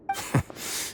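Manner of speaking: short breathy scornful laugh; mocking laugh. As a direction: laugh him off. Villain mocks after threat.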